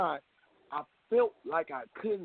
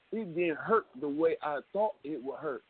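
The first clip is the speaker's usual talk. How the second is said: ordinary talk, nothing marked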